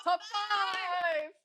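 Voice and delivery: excited announcement. Real, not acted. Host announcing segment.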